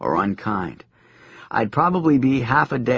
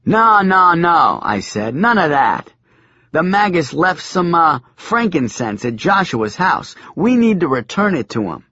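urgent protest; 6 semitones higher, 4 dB louder than usual. A narrator's reading.

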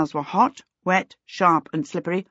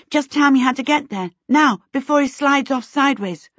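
eager, louder, high plea; urgent; 8 semitones higher, 4 dB louder than usual. A narrator's reading. Charmaine urging Sim to send her, loud and urgent. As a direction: push to be sent. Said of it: "jigging with impatience".

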